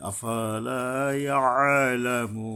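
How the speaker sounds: আফালা with the la held one count, then ইয়ালামু; one-alif madd. Shown as a demonstration. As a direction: stretch the la of আফালা, slow and chanted. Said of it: "লা এর উপর এক আলিফ টানতে হবে".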